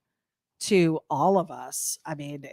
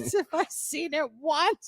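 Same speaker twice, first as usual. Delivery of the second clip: higher, slow mock dad catchphrase; light laughing impression of dad; imitating dad's saying; 9 semitones higher, 3 dB quieter, slower than usual. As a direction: ham up the old man's line, laughing. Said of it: "My dad always says it".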